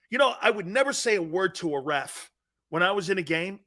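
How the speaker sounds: usual speaking voice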